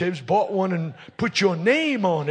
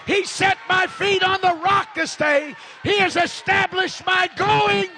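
preaching at full force; 7 semitones higher than usual. Real, not acted. Preacher lifting the congregation in full-force preaching. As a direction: pour it out over the church.

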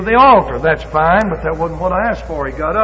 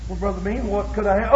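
mock answer; 3 semitones higher, 7 dB quieter than usual. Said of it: "Brother Lane answering softly".

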